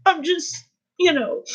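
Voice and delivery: high, softened words through tears; real tearful voice. Real, not acted. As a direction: falter while crying. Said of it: "I should be crying".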